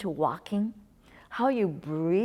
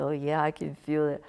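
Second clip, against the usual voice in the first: lightly voiced self; 4 semitones lower than usual. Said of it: "I say".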